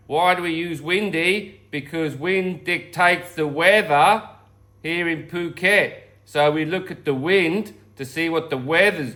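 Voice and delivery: slow, droning Australian accent, lightly put on; Sean's Australian accent. [name] standing in for absent Sean, slow mock Australian accent. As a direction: drawl it out like a laid-back Aussie. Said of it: "It's drone, isn't it, the Australian accent?".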